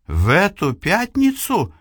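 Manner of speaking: high, alarmed question; frightened. Acted. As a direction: ask it in sudden fright. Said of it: "испуганно переспрашивает Эйвилна". (испуганно)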